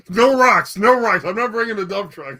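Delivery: high, loud playful refusal; joking loud protest. Real, not acted. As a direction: shout it down laughing at your buddy. Joking refusal to haul rocks.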